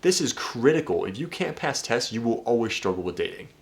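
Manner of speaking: usual speaking voice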